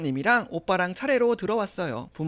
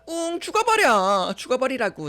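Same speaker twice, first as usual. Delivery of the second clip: cruel taunt; 7 semitones higher, 4 dB louder than usual. Acted. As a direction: push the words out mockingly. Sister-in-law tells her to die. (독하게 비꼬는 말투)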